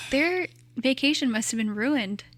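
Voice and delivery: normal talking voice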